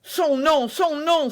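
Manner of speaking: frantic shout for a name; urgent cry. Acted. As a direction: demand the name in alarm. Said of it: "s'écria D'Artagnan".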